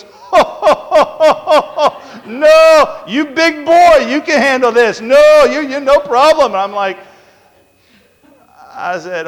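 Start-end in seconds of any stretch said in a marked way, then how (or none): 0.0-2.2 high, staccato imitated "ho ho" laugh; mock hearty laugh. Doctor laughing off the plea for numbing, high mock laugh. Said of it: "And she goes". 2.3-6.5 high, loud mock Indian accent; dismissive reassurance in a put-on Indian accent; imitated Indian doctor. Doctor telling big boy he can handle it, exaggerated Indian accent. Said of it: "she talked like this".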